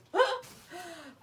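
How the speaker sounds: bright burst of laughter. Real, not acted. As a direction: laugh at your own joke. Laughs at own joke.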